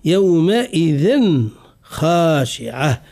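يومئذ slowly, final n distinct before خاشعة; deliberate recitation, unmerged n in يومئذ خاشعة; clear tanween. Shown as a demonstration. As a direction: sound the n ending of يومئذ clearly, then long خاشعة. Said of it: "نتعمد ونتكلف في إظهار التنوين".

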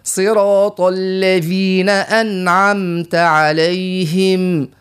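slow, drawn-out recitation; Quran recitation. Shown as a demonstration. Teacher finishes the Fatiha, slow and measured. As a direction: recite the closing verses with full tajwid.

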